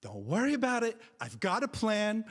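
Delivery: high, reassuring mom impersonation; mom's voice. Retold or imitated. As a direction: imitate a mother taking charge on the phone. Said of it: "And she's like".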